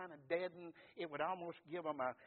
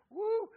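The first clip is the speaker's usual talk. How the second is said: high, loud laughing whoop; joyful whoop; 14 dB louder than usual. Real, not acted. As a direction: let out a burst of joy. Preacher exults after the quote.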